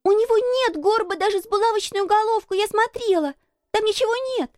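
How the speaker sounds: put-on very high, indignant little girl; high girlish outburst; indignant cry. A narrator's reading. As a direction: protest hotly, pitch held right up. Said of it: "с негодованием громко крикнула Мэри".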